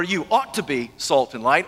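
"ought" stressed, line left hanging. Shown as a demonstration. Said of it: "he doesn't say".

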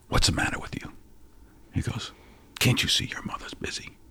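stern whispered rebuke; hushed scolding. Retold or imitated. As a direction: scold him under your breath. Grandfather scolds whining boy.

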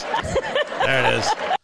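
slow, soft, laughing through the words. Shown as a demonstration. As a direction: drag it out while chuckling. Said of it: "I'm not doing it right".

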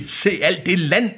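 high, marvelling villain voice; acted amazement. A narrator's reading. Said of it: "sagde Deacon".

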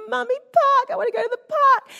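put-on high, loud wailing toddler voice, a bit quick; crying toddler. Toddler son crying to mum in the car, high loud wail. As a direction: wail it like an upset little boy stuck in the car seat. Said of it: "he gets all upset and he's crying".

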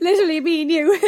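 loud laughing burst. Real, not acted. Height contrast joke.

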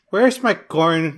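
slow, drawn-out cartoon Mexican accent. Co-host ignores pleas to stop, slow cartoon Mexican accent. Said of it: "that Slowpoke Rodriguez one".

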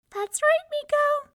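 very high, loud little mouse squeak; tiny voice. A narrator's reading. Unseen mouse answers the disappointed young man in a tiny voice. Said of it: "came a tiny voice".

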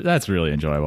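usual speaking voice

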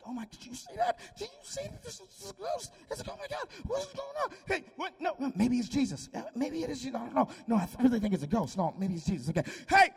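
high, loud frantic chatter; panicky disciples. Acted. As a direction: squeal like scared fishermen arguing. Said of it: "Peter and the boys like".

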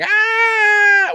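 high loud cartoon yell; imitated yell. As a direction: let out a big animated cry. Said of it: "running across and going".

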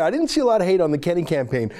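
normal talking voice

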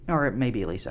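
ordinary delivery

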